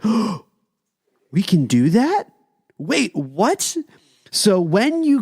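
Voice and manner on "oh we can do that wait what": mildly incredulous, voice rising at the ends; mock astonished questions; retold amazement. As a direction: act out being amazed by the news. Retold astonishment at locals' ordering.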